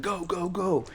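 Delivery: high-pitched mock battlefield call; retold urging call. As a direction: imitate someone urging men to run. Calling out in retold combat.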